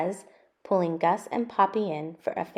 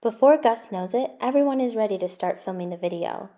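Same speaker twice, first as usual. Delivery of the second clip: ordinary delivery, nothing marked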